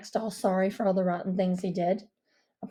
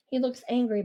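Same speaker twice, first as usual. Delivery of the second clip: plain reading voice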